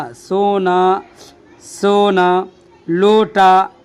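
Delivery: ordinary talk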